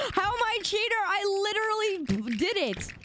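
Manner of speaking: high, squeaky protest; indignant protest. Real, not acted. Streamer accused of cheating on the wheel, indignant squeak. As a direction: protest your innocence high in the voice.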